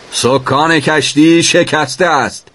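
urgent high-pitched call back. A narrator's reading. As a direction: shout the report up the stairs. Sailor calls answer up to shouter.

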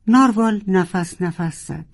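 ordinary delivery, nothing marked

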